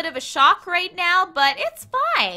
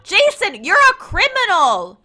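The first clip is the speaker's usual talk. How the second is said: playful raised-voice telling-off, higher and louder; mock scolding; 5 semitones higher, 6 dB louder than usual. Streamer tells off a game character for playing instead of crime, mock scolding. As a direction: scold him in mock outrage.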